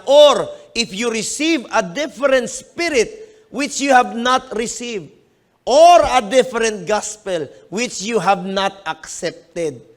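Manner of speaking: slow, deliberate Bible reading; measured scripture read. Acted. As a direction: read the verse out to the congregation. Continues reading the verse.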